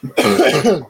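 coughing to clear throat; harsh coughs after choking. Real, not acted. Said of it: "I'm choking on the water".

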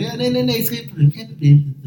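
high, eager broken Dutch; the delivery guy giving in, in broken Dutch. Retold or imitated. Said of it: "de meest gebroken taal". (hoog, gedwee gebroken Nederlands)

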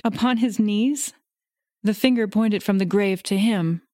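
ordinary delivery, nothing marked

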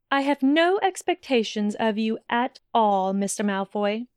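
ordinary delivery, nothing marked

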